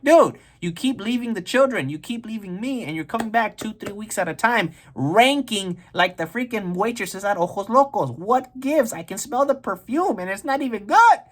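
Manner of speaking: high-pitched scolding rant; fed-up wife. Acted. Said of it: "Maria was not having it".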